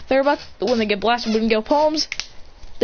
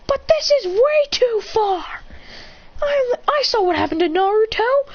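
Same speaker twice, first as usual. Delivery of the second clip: upset protest; 13 semitones higher than usual. Acted. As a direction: plead your case, distressed. Protesting the beating.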